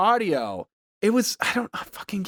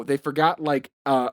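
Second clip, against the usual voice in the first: ordinary talk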